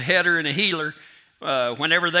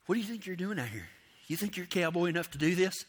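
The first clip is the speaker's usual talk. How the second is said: quick, hushed needling voice; the nagging voice at the back of your head; doubting inner voice; 11 dB quieter, faster than usual. Retold or imitated. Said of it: "that little thing in the back of your head will start saying".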